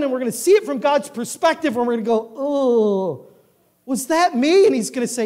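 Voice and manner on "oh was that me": drawn-out oh, then high question; recoil, then ask in disbelief; dismayed realisation. Acted. Sinner in heaven sees own sin, dismayed.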